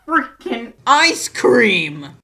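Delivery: loud, slow, stretched-out groan of disbelief; exasperated outburst. Real, not acted. Vlogger fuming that the dad runs ice cream, loud and slow. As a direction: throw your hands up at the words ice cream.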